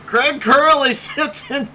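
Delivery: helpless laughter breaking up the announcement; laughing so hard. Real, not acted. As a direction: try to announce through a laughing fit. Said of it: "I'm laughing so hard".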